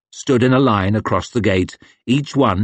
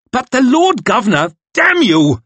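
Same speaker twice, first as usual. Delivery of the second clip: high, loud furious scream; 5 dB louder than usual. Acted. Young officer screaming at the old man, furious. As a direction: lose your temper and shriek. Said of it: "screamed the lad".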